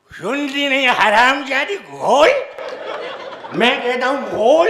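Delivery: put-on loud cursing drunk; drunk angry yell. Drunk husband cursing wife through the door, loud yell. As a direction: bellow the curse and the order.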